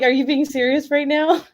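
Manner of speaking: high-pitched retold disbelief; incredulous. Retelling her reply to her sister's mix-up, incredulous. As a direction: replay an amused, can't-believe-it question.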